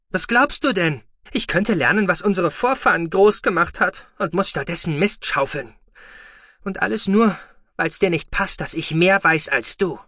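loud, high defiant yelling; the boy explodes in rage; angry outburst. Acted. Boy shouts back at foster father.

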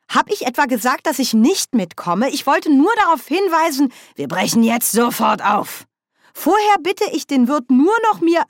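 high, loud, indignant objection; aufgebrachter Protest. A narrator's reading. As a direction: protest loudly at being hurried off. Nanny wehrt sich im Streit. (hoch und laut protestierend)

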